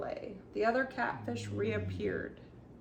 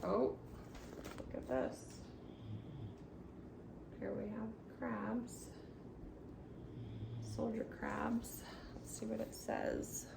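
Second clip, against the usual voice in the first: hushed aside; 11 dB quieter than usual. Real, not acted. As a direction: drop to a near whisper. Quiet aside while reading.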